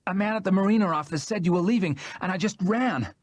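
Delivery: gasping out the news after running; hurried breathless explanation; breathless Jackie. Acted. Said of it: "she explained her breathlessness".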